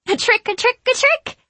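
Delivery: very high, loud gleeful squeal. A narrator's reading. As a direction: squeal it while clapping. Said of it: "clapping gleefully".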